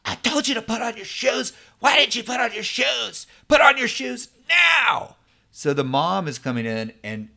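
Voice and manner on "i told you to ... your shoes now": mom's voice shoots way up, building to a loud shout; angry mom. Retold or imitated. Mother yelling at her son about his shoes, angry shout building. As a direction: scold the kid higher and louder each line, ending in a yell. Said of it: "she responds with anger".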